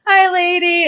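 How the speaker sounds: high, cheerful-sounding retold call; high-pitched imitation of a hail. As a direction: call up to a lady from outside. Retelling Fezzik calling from outside.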